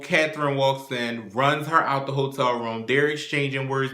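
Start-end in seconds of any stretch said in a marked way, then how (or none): none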